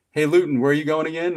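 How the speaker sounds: high, quick, loud teasing call; joking instructors. Retold or imitated. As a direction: tease like an instructor feigning innocence. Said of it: "jokingly manner".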